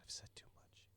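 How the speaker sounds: hushed, unvoiced regret; whisper. Elf woman regretting her words, whispering. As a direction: breathe it out as if you slipped. NPC catches herself, secretive.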